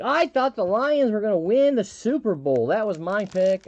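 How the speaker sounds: put-on high, loud gushing girl voice; chatty girl. Tipsy girl rambling about football, high and gushing. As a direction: rattle on excitedly about your pick. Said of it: "she's like".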